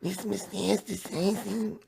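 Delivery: high, slow squeaky Pikachu voice. Pretend. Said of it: "Let me try to do the Pikachu voice".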